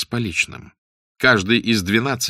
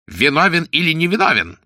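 raised, high prosecutor's cry; shouting; 7 semitones higher, 3 dB louder than usual. A narrator's reading. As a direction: demand an answer in a high shout. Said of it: "закричал он". (крик прокурора)

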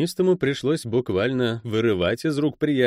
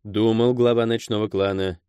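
usual speaking voice

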